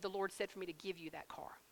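soft hushed whisper of the friend's words; whispering the friend's message; whispered quote. Retold or imitated. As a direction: breathe it like a secret. Friend's quote told as a secret.

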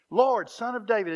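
crying out. Acted. Blind men calling to Jesus, loud cry. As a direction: call out to Jesus. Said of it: "cried out all the more".

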